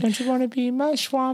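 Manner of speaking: very high, soft mock invitation; high teasing voice. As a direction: tease it in a high falsetto. Mocking sing-song high voice.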